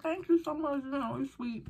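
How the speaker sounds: high, sugary thanks; sweet thank you. Retold or imitated. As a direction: gush a little while thanking. Thanking her friend in story.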